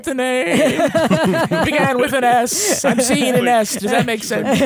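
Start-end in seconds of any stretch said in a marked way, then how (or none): 2.8-4.6 higher, hammed-up fortune-teller voice, laughing through it; mock psychic. Teasing the caller who heard things, mock stage psychic. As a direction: fish for a name like a stage psychic. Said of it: "maybe she's psychic".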